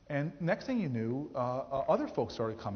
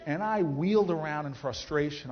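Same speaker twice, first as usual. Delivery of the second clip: normal talking voice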